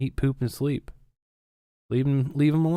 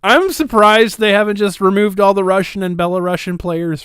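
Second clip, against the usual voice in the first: sarcastic loud; 8 semitones higher, 8 dB louder than usual. Real, not acted. Sarcastic outburst.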